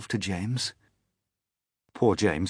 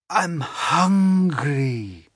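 high, slow hungry spider; 8 semitones higher, 4 dB louder, much slower than usual. Acted. Spider telling James she is hungry, slow and high.

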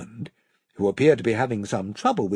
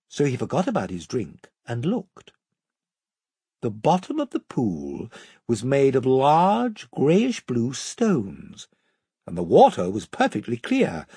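ordinary delivery, nothing marked

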